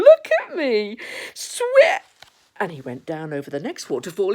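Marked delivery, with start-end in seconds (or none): 0.0-2.0 high, loud squeal; drawn-out, delighted child's squeal; Roo. Acted. Roo showing off from the pool, high gleeful squeal.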